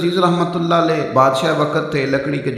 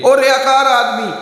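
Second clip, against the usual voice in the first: calling out insult; 8 semitones higher, 4 dB louder than usual. Retold or imitated. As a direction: yell it after him like a taunt. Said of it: "پیچھے سے آواز دی".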